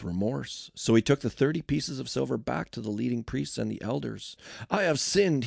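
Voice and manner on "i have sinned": higher-pitched, emphatic declaration; declared confession. A narrator's reading. Judas confessing to the priests, declared with raised pitch. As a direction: declare it openly, voice lifted. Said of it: "he declared".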